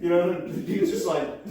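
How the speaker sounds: normal talking voice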